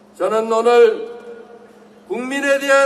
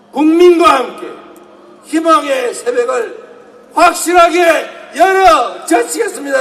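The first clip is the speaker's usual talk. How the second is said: fiery revolutionary speech; 4 semitones higher, 6 dB louder than usual. Real, not acted. Rally crowd-rousing climax.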